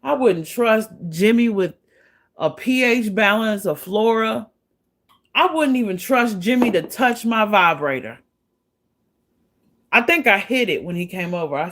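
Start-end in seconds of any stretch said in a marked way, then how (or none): none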